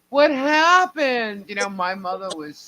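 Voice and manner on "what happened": high, slow, shocked question; shocked cry. Retold or imitated. Seeing bald sister.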